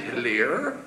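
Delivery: low, soft questioning hello; composed operator, voice dropped low; telephone operator. Acted. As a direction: answer the call calmly and quietly. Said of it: "he dialed the operator".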